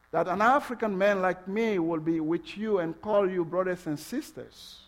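ordinary talk, nothing marked

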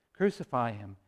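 low, soft quote of the crowd; subdued retold demand. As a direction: repeat the crowd's cry quietly and low. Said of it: "when Pilate says".

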